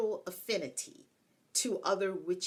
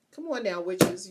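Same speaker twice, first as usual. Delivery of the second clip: ordinary delivery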